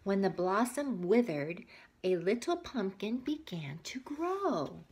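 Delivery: put-on reading a picture book aloud to kids; story narration. A narrator's reading. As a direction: tell it gently to little listeners.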